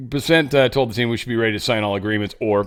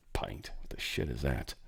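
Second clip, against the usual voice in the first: lower, softer whispered aside; 10 semitones lower, 15 dB quieter than usual. Real, not acted. The host, baffled by pint sizes, muttering a whispered aside.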